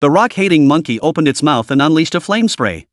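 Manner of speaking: ordinary delivery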